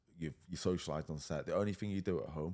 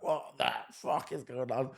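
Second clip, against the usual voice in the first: panicked disbelief; 8 semitones higher, 6 dB louder, slower than usual. Retold or imitated. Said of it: "I was like".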